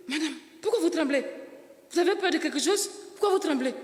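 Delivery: high, puzzled doctor asking; the doctor, high and perplexed, asking why she trembles; concerned doctor. Retold or imitated. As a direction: press her gently but insistently. Doctor questioning trembling patient.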